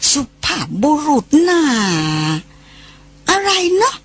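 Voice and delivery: put-on old man mangling สุภาพบุรุษน่า, pitch climbing; puzzled mishearing. A narrator's reading. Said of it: "บุญคำฟังไม่ถนัด".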